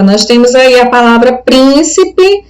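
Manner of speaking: ordinary talk, nothing marked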